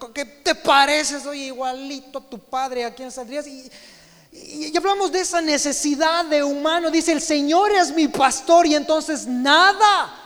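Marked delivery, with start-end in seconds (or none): 4.7-10.1 loud, higher proclamation of the psalm; fervent preaching. Real, not acted. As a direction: proclaim it to the whole church. Preaching at full force.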